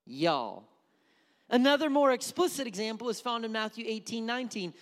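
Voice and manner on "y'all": Southern y'all. Shown as a demonstration. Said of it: "it's y'all".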